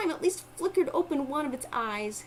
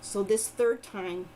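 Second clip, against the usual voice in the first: plain reading voice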